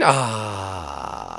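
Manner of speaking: long, low trailing "ahh"; really deep voice. Pretend. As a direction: let the voice sink deep and fade. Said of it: "your voice got really deep there".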